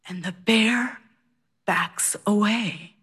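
hushed whisper, slowing down. Real, not acted. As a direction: drop to a breathless whisper. Tense climax of the bear story.

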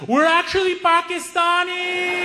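high, slow primly correcting voice; earnest naive friend correcting a stranger; prim correction. Retold or imitated. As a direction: correct him politely, very high. Hamza correcting the racist guy.

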